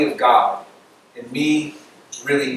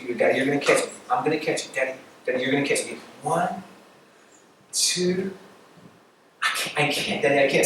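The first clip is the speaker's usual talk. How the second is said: softly imitated anxious child; small, hesitant voice seeking dad's assurance; mock nervous countdown, backing out; nervous little girl; 2 semitones lower, 9 dB quieter than usual. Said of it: "the girl's just nervous".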